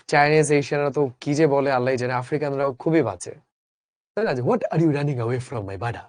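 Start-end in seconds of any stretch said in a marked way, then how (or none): 4.4-6.0 put-on slow, lightly done African accent; a light imitation of an African accent, fading low at the end. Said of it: "আফ্রিকানরা বলে". (হালকা African উচ্চারণে)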